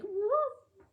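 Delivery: high, squeaky mock dog woof; imitated husky whine. Telling friends about the groomer, mock whining woof. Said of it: "totally whining".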